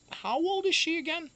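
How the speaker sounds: high, light mimic of the vet asking. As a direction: lift into the vet's voice. Vet on the phone.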